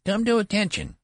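high, loud treble command; attempted thunder. A narrator's reading. Boy general commanding the sentry, attempted thunder. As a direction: try to thunder in a boy's treble. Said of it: "he tried to thunder".

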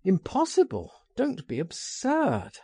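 high, incredulous comeback; sharp retort. A narrator's reading. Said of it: "I retorted".

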